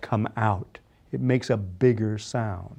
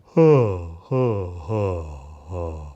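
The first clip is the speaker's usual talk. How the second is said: huffed huh sinking lower and fading; yawn huh; 7 semitones lower, much slower than usual. Shown as a demonstration. Coach mimics the sound everybody makes yawning. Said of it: "It's a kind of a".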